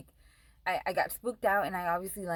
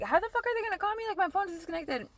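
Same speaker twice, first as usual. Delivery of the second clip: much higher, rushed, panicked outburst; frantic disbelief; 11 semitones higher, faster than usual. Retold or imitated. Snapping at her boyfriend over the phone, a frantic high-pitched rant. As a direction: blurt it out fast and shrill with panic.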